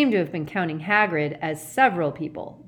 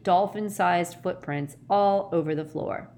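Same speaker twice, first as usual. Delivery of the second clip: ordinary delivery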